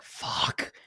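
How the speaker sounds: a soft, breathless whispered fuck; whispered curse. Retold or imitated. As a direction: mutter it under your breath in dread. Kid dreading dad walking in.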